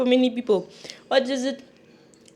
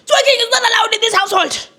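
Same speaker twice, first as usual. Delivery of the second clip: much higher and louder mock scold; mock strict parent; 11 semitones higher, 8 dB louder than usual. Scolding herself as a joke, a high loud mock parent. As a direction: lay down the house rule like a strict mum.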